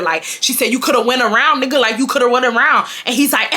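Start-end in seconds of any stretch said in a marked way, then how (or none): none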